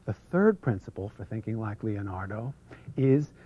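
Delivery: usual speaking voice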